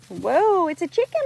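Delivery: ordinary delivery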